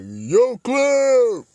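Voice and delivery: loud, high, bellowed call-out; hammed-up boxer shout; Rocky Balboa. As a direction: holler it like a movie boxer. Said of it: "didn't go Rocky Balboa".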